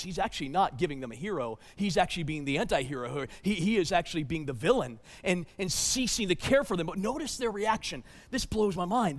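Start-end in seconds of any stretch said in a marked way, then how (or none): none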